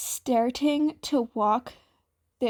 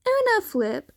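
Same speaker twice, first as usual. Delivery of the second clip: chirpy; 11 semitones higher, 3 dB louder than usual. Acted. Answer in unison.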